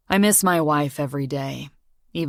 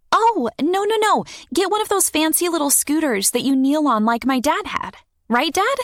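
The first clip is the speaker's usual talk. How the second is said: put-on much higher, eager girl's voice; excited young girl; 13 semitones higher, 3 dB louder than usual. A narrator's reading. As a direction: chatter like an excited kid. Child Morgan voiced high.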